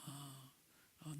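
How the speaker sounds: ordinary talk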